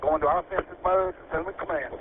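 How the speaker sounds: radio size-up, over the air; urgent firefighter radio. Real, not acted. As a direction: call it in over the fire radio. First engine size-up over radio.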